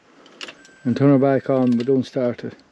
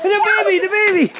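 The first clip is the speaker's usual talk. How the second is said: laughing. Real, not acted. Laughing at the toy baby.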